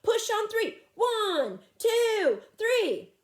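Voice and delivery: high loud commanding count; calling instructions. Acted. Fred directs the cavewomen at the rock, loud high commands. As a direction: bark the orders and count it off. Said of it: "calling out instructions".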